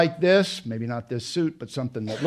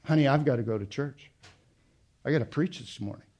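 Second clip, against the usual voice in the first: mock offhand excuse; 6 dB quieter, much faster than usual. Mock callous husband quote.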